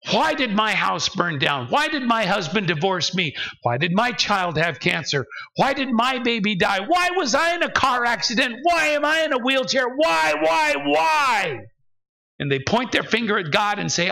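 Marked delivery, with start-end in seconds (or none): 0.1-11.6 anguished whys building to a high-pitched cry; shouting at God. Acted. Angry believer demanding answers from God, building to a shout. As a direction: start near normal, climb to an acted shout. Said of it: "and shout at him".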